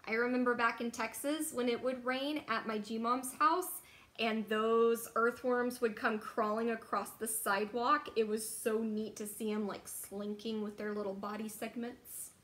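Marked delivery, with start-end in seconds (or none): none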